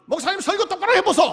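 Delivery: put-on high, loud old woman's voice, laughing; grandmother's retort. Grandmother tells the pastor to preach properly, loud and laughing. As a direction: fire back at the pastor, laughing.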